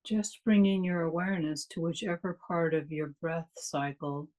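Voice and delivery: calm, unhurried meditation guide with long pauses; measured meditation delivery, leaving space after each phrase; guided meditation. Real, not acted. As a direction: lead listeners gently into stillness, phrase by phrase.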